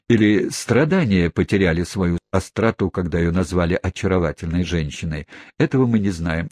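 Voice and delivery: ordinary delivery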